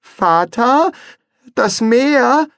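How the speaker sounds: high, loud urgent call; young son warning. Acted. Les warns his father about the sea, high and loud.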